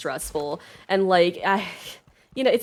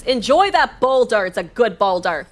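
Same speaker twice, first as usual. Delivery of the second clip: normal talking voice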